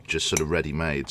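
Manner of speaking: ordinary talk, nothing marked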